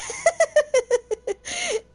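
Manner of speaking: high, falling peal of laughter; real high-pitched laughing fit; burst of laughter. Real, not acted. Streamer laughs at game joke.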